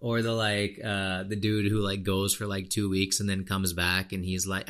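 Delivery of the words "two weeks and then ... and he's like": ordinary talk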